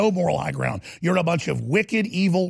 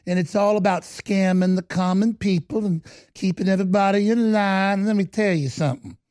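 mock sweet Southern voice. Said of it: "real sweet little voices".

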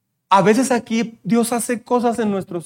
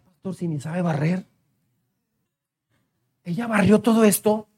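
much softer, lower amazed mother; a mother marvelling to the pastor; quoting a mother; 5 semitones lower, 8 dB quieter than usual. Retold or imitated. Pastor quotes a mom, softly amazed. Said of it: "Una mamá me dijo".

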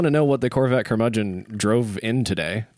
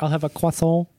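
mock French croissant; 4 semitones higher than usual. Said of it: "the people that go".

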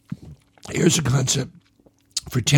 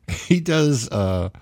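ordinary talk, nothing marked

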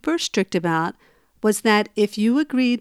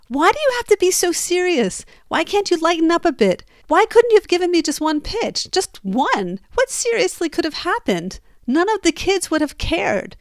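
higher, pleading-angry outburst of the husband; letting it all out; 7 semitones higher than usual. Retold or imitated. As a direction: vent every complaint at once. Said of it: "And he let it all out".

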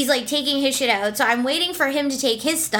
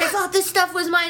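flustered excuse; 7 semitones higher than usual. Retold or imitated. Voicing the flustered man's excuse.

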